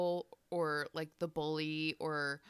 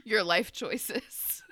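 slow, louder punchline of a telling-off; mock confrontation; 4 semitones higher, 5 dB louder, much slower than usual. Ending an imagined telling-off of the bully, mock confrontation. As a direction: draw out the mock reproach, pitched up.

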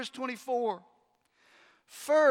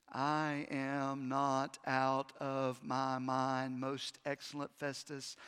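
calm sober reply; 6 semitones lower, slower than usual. Acted. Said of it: "Paul says".

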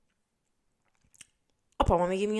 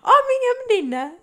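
much higher, louder mock wail; a hammed-up crying mother; 12 dB louder, slower than usual. Mother phoning her daughter, mock wailing, high and loud. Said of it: "vai dizer".

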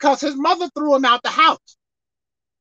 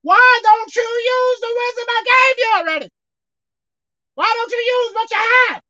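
voice of God; 8 semitones higher than usual. Retold or imitated. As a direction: call down a stern rebuke from heaven. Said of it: "The Lord spoke to me in an audible voice and said".

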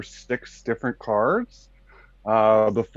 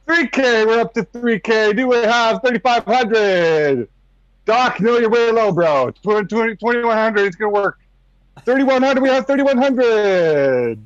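calling out auction bids; auctioneer; 9 semitones higher, 3 dB louder than usual. Acted. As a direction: sell it like an auctioneer.

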